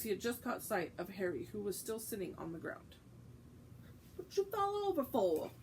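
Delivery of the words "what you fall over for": high, mocking cockney-ish lad; cheeky conductor. Stan teasing Harry sprawled on the ground, cheeky. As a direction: tease him for falling over.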